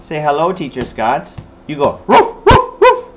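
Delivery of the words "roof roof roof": high, short yapping barks; imitated dog bark. Dog replying to say hello, high yappy barks. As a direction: yap like a small dog.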